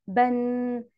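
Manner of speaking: "بن" on a level pitch, ending in a hummed n; audible final nun. Shown as a demonstration. Teacher has pupils listen for tanween, final n ringing in "بن". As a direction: hold the final n of "بن" like a ringing bell. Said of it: "أول ما أسمع جرس موسيقي".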